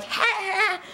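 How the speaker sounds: high, soft fake laugh; a breathy put-on high ha ha; mock laugh. Imitating how you laugh leaning forward, high and soft. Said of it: "you know how when you laugh".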